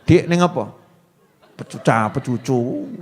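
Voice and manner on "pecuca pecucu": low, soft pouting mumble; a sulky wife's pouting mumble; mock sulky wife. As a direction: pout it out like a sulking wife. Mocking a sulky wife's face.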